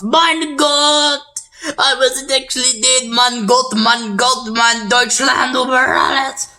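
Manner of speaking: badly faked German dictator, high and loud; hammed-up high-pitched ranting, last words stretched out; Adolf Hitler. Drowning dictator cries out he isn't dead, hammy impression. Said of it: "That was the worst Hitler impression ever".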